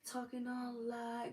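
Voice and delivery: high, soft, drawn-out meek voice; exaggerated slow, sweet meekness; mock meek woman. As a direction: put on a dainty, demure voice. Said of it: "every woman is supposed to be meek".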